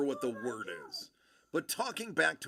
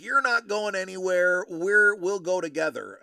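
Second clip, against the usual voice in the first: mock-bossy loud order, a bit higher; loud insisting chief; 5 semitones higher, 11 dB louder than usual. Senior chief telling the young sailor he's coming along, loud. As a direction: boom it out like a drunk boss who won't take no.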